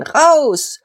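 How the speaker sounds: high, forceful command to leave; angry shout. A narrator's reading. Angry opponents grabbing Diederich, shouted order. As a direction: shout him out of the hall.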